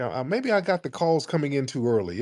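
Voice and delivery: ordinary talk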